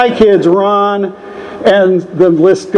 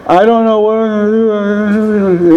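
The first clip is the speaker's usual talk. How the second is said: higher, slow, whiny retold plea; mock blubbering; 6 semitones higher, slower than usual. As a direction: imitate your past self blubbering to your sponsor. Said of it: "blubbering about the problem".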